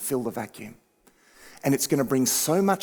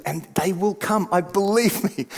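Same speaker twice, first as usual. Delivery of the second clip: ordinary delivery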